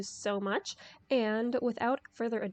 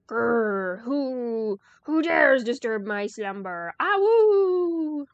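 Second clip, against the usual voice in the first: loud growling wolf voice; big bad wolf; 10 dB louder, slower than usual. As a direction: boom it out like a fearsome beast. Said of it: "there's someone out there".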